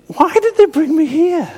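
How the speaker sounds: high, dismayed disbelief; baffled. Retold or imitated. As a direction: ask it in grown-up dismay. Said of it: "you think".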